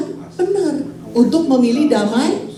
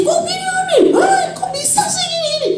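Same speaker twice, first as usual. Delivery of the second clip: very high-pitched, worked-up complaint; hammed-up exasperated squawk; mock emotional outburst. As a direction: act out losing your temper, voice shooting up high. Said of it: "kalau misalnya satu emosi".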